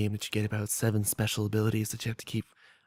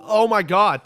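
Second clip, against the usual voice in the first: loud startled outburst; surprised shout; 10 semitones higher, 10 dB louder, slower than usual. Real, not acted. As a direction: yelp at the sudden sound. Startled by a notification.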